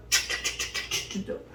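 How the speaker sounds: rapid repeated stabbing noises; battle sound effect by mouth; imitated sword slashes. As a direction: mimic quick blade strikes.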